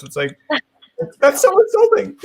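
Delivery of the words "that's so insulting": very high, loud mock outrage; mock offence. Duo member unrecognised without the other, mock offended. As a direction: squeal it in playful indignation.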